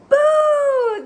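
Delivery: high, loud surprise boo; playful boo. Acted. Host revealing the hidden duckling to kids, high loud boo. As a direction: pop out with a big boo. Peekaboo reveal in kids' book.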